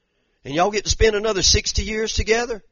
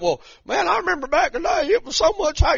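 mock nostalgic churchgoer; 8 semitones higher, 4 dB quieter than usual. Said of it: "people's like".

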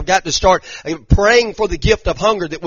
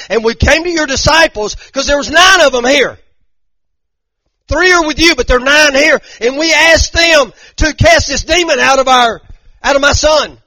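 much higher and louder, a pleading near-shout; desperate plea; 12 semitones higher, 10 dB louder than usual. Acted. Father begging Jesus to heal his son, shouting. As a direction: cry out to Jesus for your son.

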